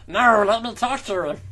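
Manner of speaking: high, loud put-on villain voice; hammed-up sinister voice. Reading a villain line aloud.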